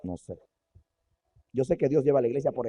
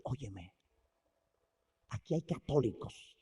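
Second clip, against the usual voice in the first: hushed question; 4 semitones lower, 9 dB quieter, slower than usual. Acted. Wesley asks softly in hell.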